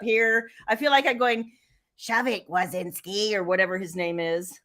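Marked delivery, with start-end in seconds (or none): 2.0-4.6 a lightly done low, dry Roz voice, softer; Roz impression. Author jokes about her hair by imitating Roz from Monsters Inc. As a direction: drone the name like a bored clerk. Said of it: "I could look like Roz from".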